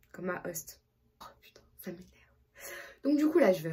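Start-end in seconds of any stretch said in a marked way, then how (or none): 0.1-2.1 annoyed whisper under breath; whispered annoyance. Real, not acted. Vlogger annoyed at her host mom, whispering. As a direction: mutter it so the host can't hear.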